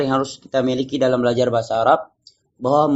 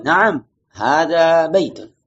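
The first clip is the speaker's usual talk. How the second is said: Naam, hadha baitun very slow, falling at the end; "Naam, hadha baitun" drawn out for repetition; answering intonation; 2 semitones higher, much slower than usual. Shown as a demonstration. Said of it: "Kalau menjawab berarti intonasinya".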